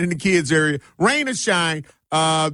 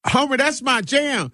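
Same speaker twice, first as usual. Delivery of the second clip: high excited fan voice; mock fan; 11 semitones higher than usual. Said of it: "be like".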